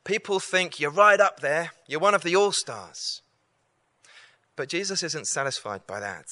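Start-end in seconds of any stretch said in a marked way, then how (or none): 0.0-3.2 higher, louder, eager disciples; voicing the disciples. Preacher voicing the disciples telling Jesus he's an all-star. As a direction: pitch it up like eager friends bragging. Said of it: "The disciples are saying".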